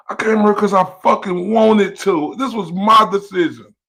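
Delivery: loud and heated, pitch up; real angry yell; angry shouting. Real, not acted. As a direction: shout him down, fired up. Shouting in an online argument.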